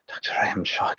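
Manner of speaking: whispered under the breath, unvoiced; muttering the name under his breath to steady himself. Shown as a demonstration. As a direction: whisper the name to yourself, psyching up. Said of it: "muttering under my breath".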